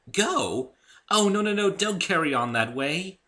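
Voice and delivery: high, loud and flustered; Rarity hastily reassuring her guest; hurried. Acted. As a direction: rush to stop him leaving. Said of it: "said Rarity hurriedly".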